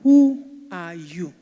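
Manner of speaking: slow, loud, every word weighed; forceful question. Shown as a demonstration. Preacher confronting the congregation, slow and loud. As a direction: challenge the room to answer. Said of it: "preacher challenging the congregation".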